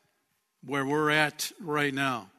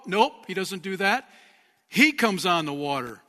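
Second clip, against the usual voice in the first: normal talking voice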